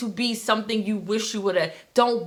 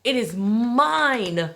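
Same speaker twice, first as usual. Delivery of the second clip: It is mine drawn out slowly, each word weighted; very slow emphatic; much slower than usual. Shown as a demonstration. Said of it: "repeated affirmation".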